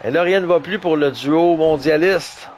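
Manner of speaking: ordinary talk, nothing marked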